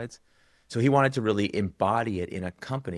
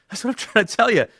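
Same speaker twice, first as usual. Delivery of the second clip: laughing; 9 dB louder, faster than usual. Real, not acted. Telling the founder the obvious, laughing. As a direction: laugh breaks through, much higher and louder.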